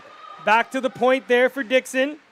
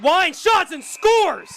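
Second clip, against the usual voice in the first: goal call; 6 semitones higher, slower than usual. Real, not acted.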